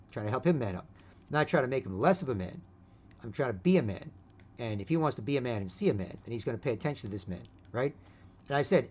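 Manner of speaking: ordinary delivery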